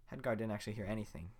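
ordinary talk

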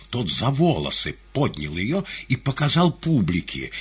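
ordinary delivery, nothing marked

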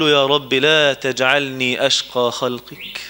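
low, slow, drawn-out supplication; humble plea. Acted. A servant begging his Lord not to leave him wretched, humbly pleading. Servant begs God for mercy.

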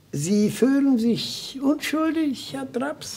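high, slow, searching voice; probing question. Acted. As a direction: lift the voice high and slow, as if testing him. Defender probes his client. (hoch und langsam, prüfend nachgefragt)

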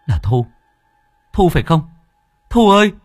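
from a startled question to a loud desperate call; calling out, building. Acted. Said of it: "miệng thẳng thốt".

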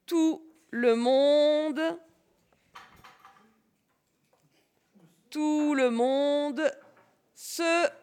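slow, word-by-word dictation; dictating. Shown as a demonstration. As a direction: say "tout le monde" slowly and apart. Dictation repeated.

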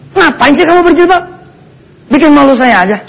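mock an irritated husband's scolding; angry husband. Husband scolding his wife for wearing the hijab, acted angry. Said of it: "suami-suami yang marah-marah".

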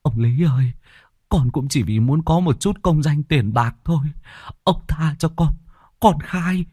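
high pleading voice; begging peasant. Acted. Beaten peasant begging the chief, high pleading.

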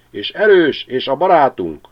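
high, loud flock cry; verebek kiáltása. A narrator's reading. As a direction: cheer it like a crowd of sparrows. Verebek kórusa folytatja. (a verebek lelkesen kiáltják, magas hangon)

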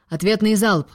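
forceful captain's command. A narrator's reading. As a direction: order the crew to fire. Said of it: "скомандовал и замер".

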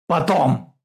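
one loud, high word; shouted. A narrator's reading. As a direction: cut him off with a shout. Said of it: "крикнул Богданов". (крикнуть, обрывая)

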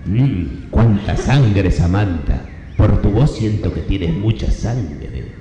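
ordinary talk

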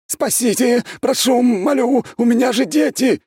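very high, loud panicked begging; one-note squealing. A narrator's reading. Prisoner begs to be saved for his children, squealing. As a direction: shriek the plea on one stretched high note. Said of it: "снова завизжал на одной ноте".

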